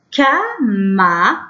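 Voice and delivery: كاماراد in slow, separated syllables. Shown as a demonstration. Teacher reading a new word to young pupils, syllable by syllable. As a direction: sound out كاماراد piece by piece. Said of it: "نقولو تي".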